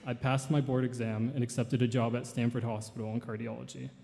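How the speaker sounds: ordinary talk, nothing marked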